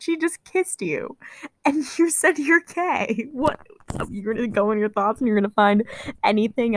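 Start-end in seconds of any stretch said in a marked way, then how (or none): 0.0-3.6 slow, high disbelief; incredulous. Real, not acted. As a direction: drag it out, baffled. Mocking disbelief at the character.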